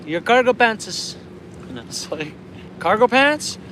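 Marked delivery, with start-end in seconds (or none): 0.0-1.1 put-on high, slow northern Manitoba native accent; retold friend's thick native Canadian accent, pitched up; thick reserve accent. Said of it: "they got thick accents". 2.8-3.6 high, loud questioning echo; puzzled, pitch leaping up on "pants"; baffled. Retold or imitated. Baffled reply to friend's odd wording.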